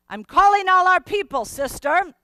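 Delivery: loud, fast, high urgent call; steward calling out. Acted. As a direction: rouse the passengers quickly.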